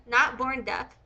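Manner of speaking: ordinary delivery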